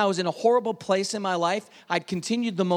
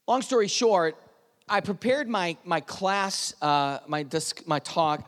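usual speaking voice

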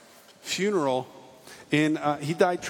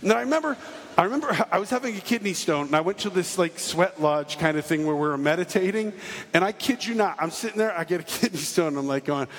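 usual speaking voice

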